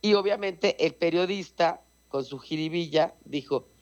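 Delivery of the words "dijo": ordinary delivery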